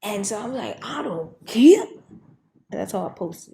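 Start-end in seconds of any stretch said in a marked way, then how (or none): 0.8-1.8 drawn-out loud defiance; loud slow defiant retort; defiant I don't care. Retold or imitated. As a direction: shout it back at God, slowly. Defiantly telling God off.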